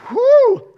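sharp relieved whistle-like whew; high loud whew. Real, not acted. Pastor relieved after a risky joke, high loud whew.

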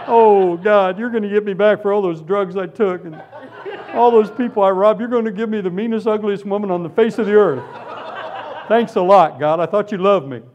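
hammed-up dismay at a raw deal; mock complaining. Mock complaint to God, retold.

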